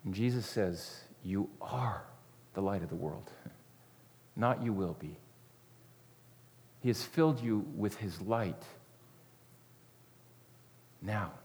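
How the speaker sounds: soft, intimate sermon voice; gentle confiding preacher; hushed preaching. Acted. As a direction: draw the listeners close, quietly.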